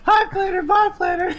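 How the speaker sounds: very high, loud cartoon voice; squeaky asteroid voice. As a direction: chirp it like a passing rock. Voicing the flying asteroid.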